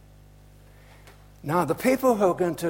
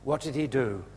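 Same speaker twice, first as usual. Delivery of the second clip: ordinary talk, nothing marked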